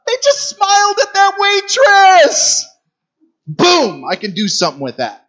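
a lightly voiced God, high and delighted; excited God. God up there watching his people, excited. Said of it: "he's up there going".

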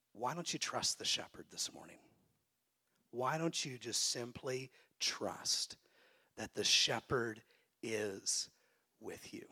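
tender whispered invitation; soft whisper. Acted. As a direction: whisper it gently to the congregation. Tender altar-call appeal.

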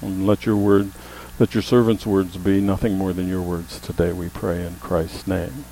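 hushed, reverent pastoral prayer. Real, not acted. Pastor asks God to help us hear his word, prayerful.

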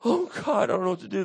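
high, anguished appeal to God; acted despairing prayer, starting high and loud; distressed sigh. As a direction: cry out, at a loss. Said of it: "When I sigh".

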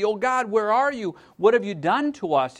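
high, loud cry of complaint; loud lament. Retold or imitated. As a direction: cry out to God in anguish. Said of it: "here she is voicing Israel".

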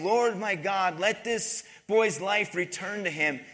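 normal talking voice

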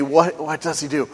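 ordinary talk, nothing marked

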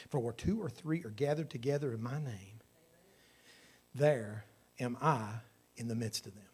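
low, hushed scripture read. Acted. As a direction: read the verse softly and solemnly.

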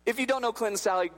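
ordinary talk, nothing marked

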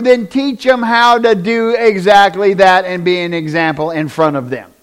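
loud, high, driving preacher's cry; fiery pulpit voice; full-force preaching. Acted. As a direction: preach it out to the back row.

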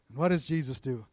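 ordinary delivery

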